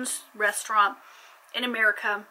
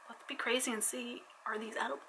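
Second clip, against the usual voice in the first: soft conspiratorial whisper; 11 dB quieter than usual. Pretend. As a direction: lean in and whisper the question. Whispered teaser question.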